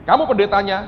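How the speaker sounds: high, forceful shout; booming acted rebuke from above; sudden loud command. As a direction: call him out with authority. God's sharp command, acted out.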